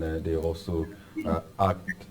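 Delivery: ordinary talk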